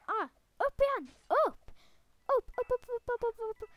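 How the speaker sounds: high, loud chant of upp; frantic urging. Real, not acted. Gamer urging his avatar to climb back up, high loud chanting. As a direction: urge yourself up the ledge, over and over.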